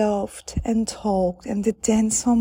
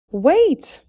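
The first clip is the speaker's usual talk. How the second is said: loud, high call after her; 8 semitones higher, 8 dB louder than usual. A narrator's reading. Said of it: "called the prince".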